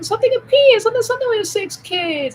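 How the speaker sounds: high, loud mock quote; mock sitcom line. Quoting a Good Luck Charlie joke, high and loud. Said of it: "where it's like".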